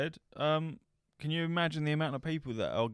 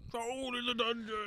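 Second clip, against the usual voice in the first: high, thin panicky Quirrell impression; 11 semitones higher, 4 dB quieter than usual. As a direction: squeak it like a terrified professor. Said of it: "like Quirrell out of Harry Potter".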